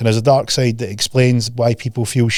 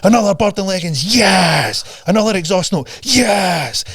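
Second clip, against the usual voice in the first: higher, hooked excitement; mock delighted scroller; 7 semitones higher, slower than usual. Coach imitating a scroller hooked on clips. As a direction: sound thrilled by each clip. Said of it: "You're like".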